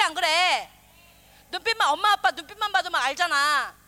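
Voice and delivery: usual speaking voice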